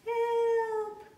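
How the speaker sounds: high, loud cry; little deer shouting for help. Acted. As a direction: call out for help, scared. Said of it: "came the cry again".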